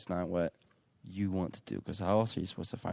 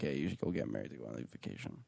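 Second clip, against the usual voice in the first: imitating dad; 8 semitones lower, 10 dB quieter, faster than usual. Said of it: "my dad's like".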